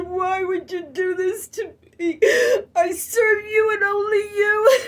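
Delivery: loud, high tearful plea; crying out. Retold or imitated. Reliving crying out to God in her room, wailing. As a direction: sob it out at God. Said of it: "And I said".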